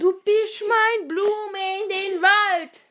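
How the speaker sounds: put-on high, slow, wailing drunk voice; drunk crying. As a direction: blubber it like a drunk.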